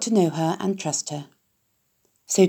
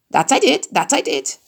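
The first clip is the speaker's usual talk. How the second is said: higher honking goose voice, doubled; 6 semitones higher than usual. A narrator's reading. Goose confirming the loose board, high and honking. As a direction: honk it twice like a goose. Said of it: "who talked rather fast".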